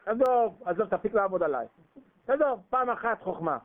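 high, loud enough already; loud retort. Acted. Jacob's man telling Laban to stop cheating, loud. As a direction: shout him down.

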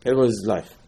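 normal talking voice

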